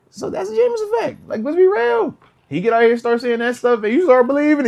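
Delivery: high and loud with mounting excitement; hyped up. Real, not acted. Host hyping the Jameis effect, high and loud. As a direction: sell how contagious his energy is.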